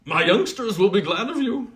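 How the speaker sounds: a hideous smith welcoming a guest with a grin; grinning goblin smith. Acted. Goblin blacksmith welcoming Colin, high and grinning. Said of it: "He grinned ... and said".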